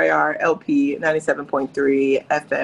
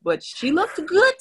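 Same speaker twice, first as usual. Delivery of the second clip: high excited exclaim; 9 semitones higher than usual. Real, not acted. Emphatic praise of Kim.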